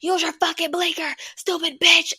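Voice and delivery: high-pitched pissed-off driver yelling at a car; mock road rage yell at another driver. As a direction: yell it like a furious driver at a bad merger. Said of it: "I'll still argue with a bitch".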